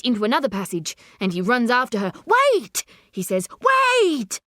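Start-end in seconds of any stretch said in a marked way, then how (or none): 2.3-2.8 high-pitched urgent shout; urgent call. A narrator's reading. Chasing the woman, calling out. 3.7-4.4 high, drawn-out shout; high shouted plea to stop; urgent call. A narrator's reading. Calls after her a second time.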